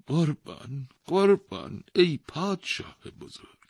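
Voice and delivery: high, loud pleading cries; terrified old man. A narrator's reading. Poor old man begging the king at the gallows, terrified. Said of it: "به وحشت افتاد".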